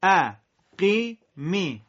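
بی ما spelled out very slowly, syllable by syllable; teacher dictating slowly for repetition; drawn-out syllables; slow model reading. Shown as a demonstration. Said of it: "بعد من تکرار بفرمایید".

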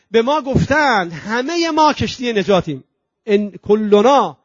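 ordinary delivery, nothing marked

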